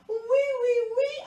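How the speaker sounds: high, loud squeal of eager agreement; excited acceptance. A narrator's reading. Girl eagerly accepts zoo outing.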